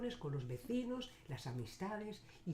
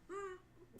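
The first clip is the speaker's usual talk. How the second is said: high-pitched effort squeak; thin, high hum of someone heaving a load; straining grunt; 9 dB quieter than usual. Acted. Man heaving a heavy sack, high strained grunt.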